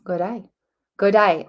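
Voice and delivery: amateur Australian accent, tried out lightly; bad Australian accent. Shown as a demonstration. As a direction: attempt an Aussie greeting. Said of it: "I'm terrible at an Australian accent".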